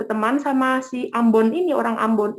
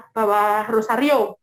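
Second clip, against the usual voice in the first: ordinary talk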